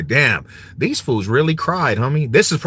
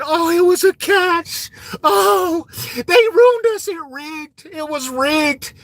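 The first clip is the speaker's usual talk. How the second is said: high, wailing fake sob; mock crying Bills fan; 14 semitones higher, slower than usual. Mocking Bills fans as a high, sobbing crybaby. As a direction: ham up a crybaby fan whining it was rigged. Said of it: "all you dudes was crying".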